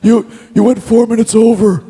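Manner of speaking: high put-on scolding voice; Johnny Ace. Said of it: "one of the first things he said was".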